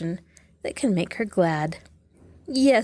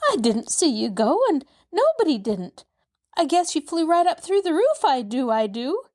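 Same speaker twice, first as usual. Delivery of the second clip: put-on high, excitable servant girl; maid voice; 8 semitones higher than usual. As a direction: rattle on like a flustered maid. Narrator voices Nancy.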